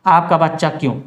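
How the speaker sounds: ordinary delivery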